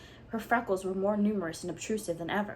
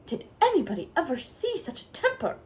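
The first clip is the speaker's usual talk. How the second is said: higher, louder shocked cry; horrified exclamation; 8 semitones higher, 5 dB louder than usual. A narrator's reading. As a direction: recoil in scandalised shock. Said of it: "exclaimed the horrified Missus Rachel".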